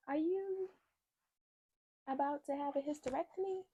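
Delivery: high, halting technician voice with long pauses; hesitant retold question.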